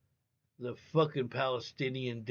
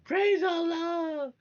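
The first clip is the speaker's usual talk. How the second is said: very high, louder crying praise; imitated mother's cry; 7 dB louder, slower than usual. Mother hearing her son's news, imitated as a very high, louder, tearful cry. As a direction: wail the praise like an overjoyed mother. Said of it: "she's like".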